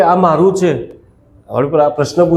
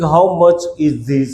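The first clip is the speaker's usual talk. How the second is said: very slow, every word laid out for the class; slow model sentence; much slower than usual. Shown as a demonstration. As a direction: say it slowly like a teacher modelling English. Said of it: "એવું પૂછવું હોય તો કે".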